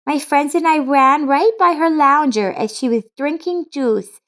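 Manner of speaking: put-on high child's voice, eager and insistent; a little girl who won't let it go. Laura insists to her father.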